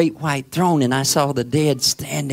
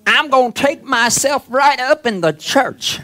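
preaching at full force; 9 semitones higher, 4 dB louder than usual. Acted. Preacher builds again.

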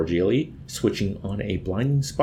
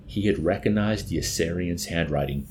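ordinary delivery

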